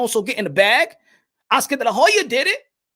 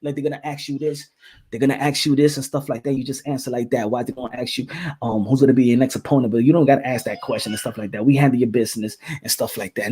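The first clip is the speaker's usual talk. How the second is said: low and hushed, feeding him lines; imitating Floyd; 11 semitones lower than usual. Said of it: "You see Floyd Mayweather?".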